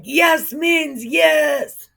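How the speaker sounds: slow, high, loud cheer; drawn-out rallying shout; enthusiastic shout. Retold or imitated. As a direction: cheer it out with everyone. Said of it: "They were like".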